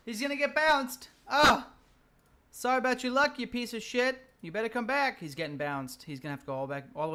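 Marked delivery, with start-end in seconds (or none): 0.1-5.2 louder and higher, gloating at the enemy; excited taunt. Real, not acted. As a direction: shout the taunt, riding the win.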